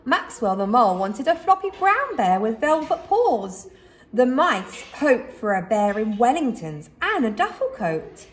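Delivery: ordinary delivery, nothing marked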